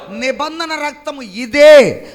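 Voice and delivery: forceful, drawn-out proclamation, ringing out; loud proclamation. Acted. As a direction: declare it loudly and slowly. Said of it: "అని గట్టిగా ఆయన చెప్పి".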